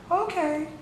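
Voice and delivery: tiny high-pitched mimicry; mock high feminine voice. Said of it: "wanna sit there and be like".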